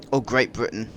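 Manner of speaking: tossing off London slang as a joke; mock British slang. British gamer to viewers, mock London street accent. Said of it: "as you can tell from my British accent".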